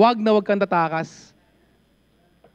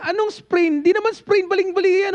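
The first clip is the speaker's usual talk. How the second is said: pitched-up shocked scolding; retold outburst; 10 semitones higher than usual. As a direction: mimic an exasperated friend scolding. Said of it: "Sabi niya".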